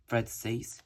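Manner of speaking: ordinary delivery, nothing marked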